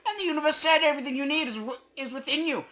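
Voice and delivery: high, loud, squeaky proclamation; squeaky universe voice. Acted. Voicing the universe to the player as a loud chipmunk.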